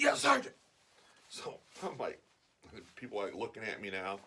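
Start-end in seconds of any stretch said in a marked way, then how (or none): 0.0-0.5 high, loud snap-to answer; obedient soldier. Retold or imitated. Old veteran answering the sergeant, imitated soldier's shout. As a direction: shout it back like a recruit. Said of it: "He's like".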